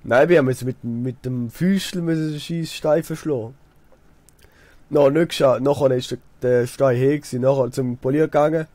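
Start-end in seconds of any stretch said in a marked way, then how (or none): none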